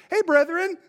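high, bright-hearted hey brethren; cheery greeting. Retold or imitated. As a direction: switch to a friendly hello. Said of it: "he just did it".

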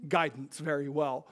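normal talking voice

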